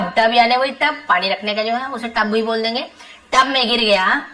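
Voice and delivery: ordinary talk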